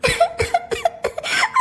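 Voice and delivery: high-pitched giggle; squeaky laugh at the outfit; teasing laugh. Acted. Daughter-in-law laughs at outfit.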